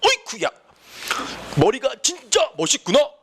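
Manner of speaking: high-pitched, hammed-up compliment; obviously faked admiration. Shown as a demonstration. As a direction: gush so hard the acting shows. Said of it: "누가 봐도 연기야". (누가 봐도 연기 같은 과장된 칭찬)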